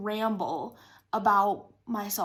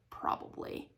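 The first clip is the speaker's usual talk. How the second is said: whispered admission; 11 dB quieter than usual. Retold or imitated. Sheepish admission to boyfriend.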